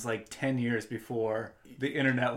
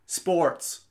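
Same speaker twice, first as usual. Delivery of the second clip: clipped, loud command to a phone menu; robotic voice command; 6 semitones higher, 6 dB louder than usual. Retold or imitated. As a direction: say it loud and flat at a machine. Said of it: "I would hear you from the other room just go".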